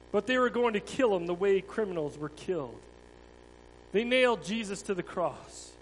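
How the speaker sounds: a dramatic storyteller's read; children's story reading. Acted. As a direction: tell it to the children with feeling. Tells the children's Passion story.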